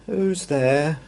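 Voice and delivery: high, squeaky and glum; sad squeaky voice. Acted. Hidden stranger answering a knock, sad squeaky voice. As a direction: squeak it out sadly from behind the door. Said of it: "a sad, squeaky sort of voice".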